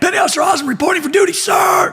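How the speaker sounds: high, fast, barked reporting-in; screamed military report. Acted. New sailor reporting to lieutenant, high screamed report. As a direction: sound off at attention in one rapid burst. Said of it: "screaming the fucking top of my lungs".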